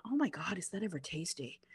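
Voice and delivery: dropping to an awed whisper; hushed delight. Real, not acted. Driver raving about the burger, hushed awed whisper. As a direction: whisper it like a guilty pleasure.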